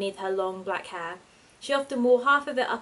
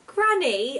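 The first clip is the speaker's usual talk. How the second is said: sharp call of Granny, a bit louder and higher; yelled; 5 semitones higher, 4 dB louder than usual. A narrator's reading. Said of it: "Alina yelled".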